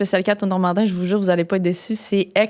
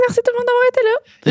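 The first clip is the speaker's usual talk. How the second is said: bright sign-off. Real, not acted. Cheerful sign-off.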